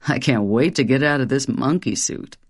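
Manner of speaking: Satan, low and dark, sly; a devil sneering at his disguise; low demon voice. Acted. Satan talks of monkey suit.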